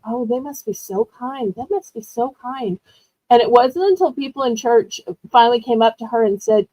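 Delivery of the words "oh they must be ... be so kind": mother's soft dismissal. Retold or imitated. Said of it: "she just kept saying".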